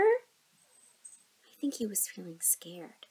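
slow soft whisper. Acted. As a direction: hush it to the kids. Soft aside to children.

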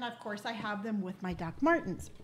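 ordinary delivery

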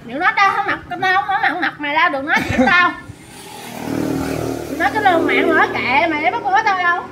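loud, high-pitched angry scolding; angry outburst. Real, not acted. Wife telling others to stop talking about her, angry outburst.

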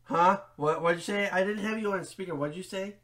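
ordinary talk, nothing marked

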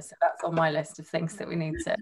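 ordinary delivery

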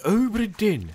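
mock-grand ship name; grand announcing tone. Shown as a demonstration. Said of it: "say it in that tone of voice every single time".

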